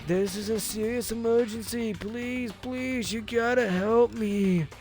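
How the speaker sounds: high, slow dazed stoner voice. Acted. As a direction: drawl it out like a stoner. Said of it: "my stoner voice for you".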